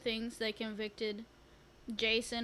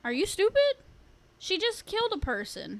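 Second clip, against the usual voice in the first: exasperated; 9 semitones higher, 3 dB louder than usual. Retold or imitated. Voicing own exasperated reaction.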